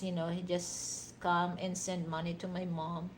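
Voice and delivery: normal talking voice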